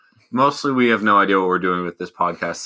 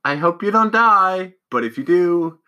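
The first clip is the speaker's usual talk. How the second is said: high, louder playful sign-off; excited sign-off; 8 semitones higher, 5 dB louder than usual. Real, not acted. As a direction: pitch it up brightly to close.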